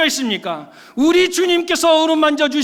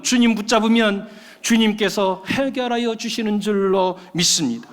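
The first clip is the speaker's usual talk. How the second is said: normal talking voice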